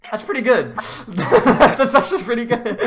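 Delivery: cracking up mid-sentence; laughing. Real, not acted. Laughing at a funny boop.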